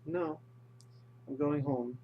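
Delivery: lightly cheerful, slightly lifted quote; mildly upbeat acted reply; happy. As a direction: happily brush off the invitation. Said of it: "like, in a happy way".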